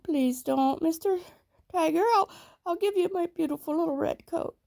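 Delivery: put-on high, pleading little boy voice; lightly voiced frightened boy; small boy pleading. A narrator's reading. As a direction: beg the tiger in a high small voice. Sambo's plea in story.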